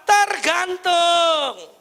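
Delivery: "Tergantung" stretched very slow; drawn out. Real, not acted. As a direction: hold "Tergantung" long and falling. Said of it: "emphatic drawn-out word".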